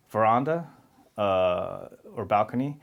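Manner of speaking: ordinary talk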